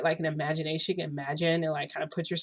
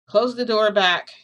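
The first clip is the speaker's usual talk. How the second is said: slow, loud, higher order; calling out; 4 semitones higher, 7 dB louder, slower than usual. Retold or imitated. Toshinori orders Midoriya from behind the counter, calling out. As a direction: call a drawn-out order across the room. Hidden man orders visitor out.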